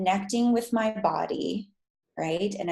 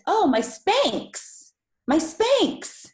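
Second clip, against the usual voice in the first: high, drawn-out, dawning surprise; sudden realization; 11 semitones higher, 4 dB louder, slower than usual. Retold or imitated. To friends, realizing her Spanx block the energy, high surprised. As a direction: gasp it out as it hits you.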